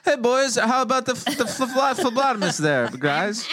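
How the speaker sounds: mock cornball joker. Teasing dad about his phlebotomy joke, hammed-up mockery. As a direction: ham it up like a corny joker working the guys.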